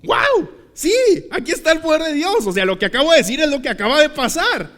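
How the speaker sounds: high-pitched, amazed outburst; imitated giddy astonishment; overexcited preacher. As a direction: exclaim it like a thrilled preacher. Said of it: "se emocionó demasiado".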